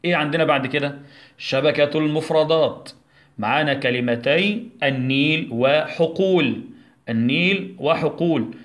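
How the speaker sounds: normal talking voice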